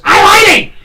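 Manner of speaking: high, loud parrot squawk; cockatoo calling out. Retold or imitated. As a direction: parrot yells at the passing lady. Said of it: "he goes".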